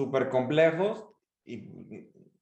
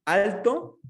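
raised, louder single word; speaking high; 6 semitones higher, 5 dB louder than usual. Shown as a demonstration. Coach showing when to speak up, high and loud. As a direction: pitch it up and project. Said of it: "¿Cuándo hablar alto".

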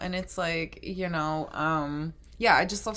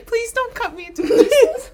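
mock plea; 8 dB louder than usual. Joking plea to co-host.

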